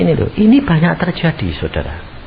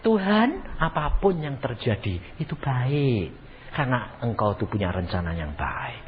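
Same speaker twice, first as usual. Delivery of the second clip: soft, lower, surrendering prayer; hushed, accepting prayer voice; model prayer; 6 semitones lower, 10 dB quieter than usual. Shown as a demonstration. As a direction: demonstrate quiet trust in God, sinking lower. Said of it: "Kamu harus mengatakan".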